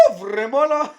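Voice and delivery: loud exclamation rising in disbelief; loud dismay. Real, not acted. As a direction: cry out in dismay at the mess. Loud dismay at messy dough.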